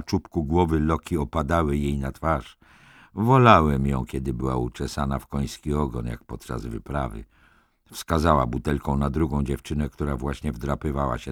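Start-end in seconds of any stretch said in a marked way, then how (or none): none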